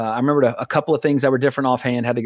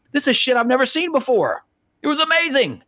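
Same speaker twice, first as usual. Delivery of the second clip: high-pitched, gushing retold wonder; amazed excitement; 11 semitones higher than usual. Fan recalling unseen footage of a favorite movie, amazed. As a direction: relive the thrill, voice pitched way up. Retelling his amazed reaction.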